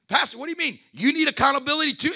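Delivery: high, teasing voice of a surprised churchgoer; mock congregant. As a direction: play the doubting listener. Mocking a congregant's question.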